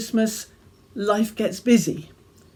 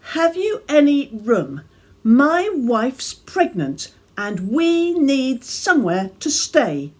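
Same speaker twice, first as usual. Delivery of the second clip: put-on higher, louder child's plea; pleading Joseph; 6 semitones higher, 5 dB louder than usual. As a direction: beg for a room, pitched up and louder.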